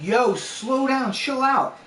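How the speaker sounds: urgent retold plea. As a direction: plead with a reckless driver, stretching the words. Panicked passenger urging speeding driver.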